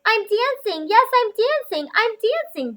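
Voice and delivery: high joyful exclaiming; thrilled giraffe crying out with joy; excited cry. Acted. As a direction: celebrate like you just learned to dance. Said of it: "Gerald cried".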